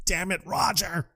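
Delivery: strained high sobbing imitation; crying. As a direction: curse the dead man in hammed-up grief. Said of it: "Chad's crying".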